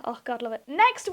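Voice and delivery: usual speaking voice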